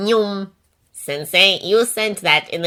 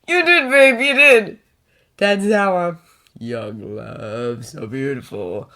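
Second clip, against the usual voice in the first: usual speaking voice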